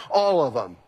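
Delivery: ordinary talk, nothing marked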